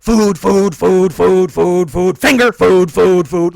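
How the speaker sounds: loud rapid-fire chant, a spike on "finger"; frantic eating dog. Retold or imitated. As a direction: gobble it like a ravenous dog. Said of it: "He's just like".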